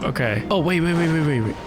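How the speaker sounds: usual speaking voice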